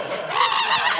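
high mock shriek; retold high scream. Reliving the scare in the dark, high mock shriek. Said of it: "I'm like".

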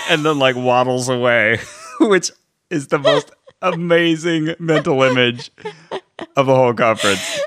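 high loud laughing through words; barely holding in laughter. Real, not acted. Laughing hard at memory.

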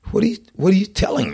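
put-on kid's disbelief. Kid's disbelief voiced in story.

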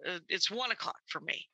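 ordinary talk

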